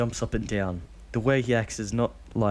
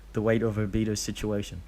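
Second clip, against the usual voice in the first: ordinary talk, nothing marked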